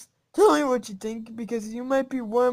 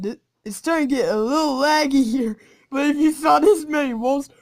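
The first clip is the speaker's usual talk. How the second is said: usual speaking voice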